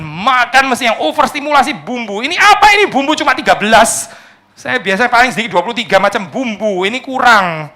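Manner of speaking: high, loud, hammed-up picky diner; spoiled eater. Preacher playing a spoiled diner complaining about spices, hammed-up and high. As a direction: play the fussy glutton who scorns the dish.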